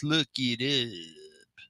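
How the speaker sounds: slow, drawn-out "look it up"; Altoona teacher's "look it up". Retold or imitated. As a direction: stretch it out like an old shop teacher. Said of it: "that's how he would say it".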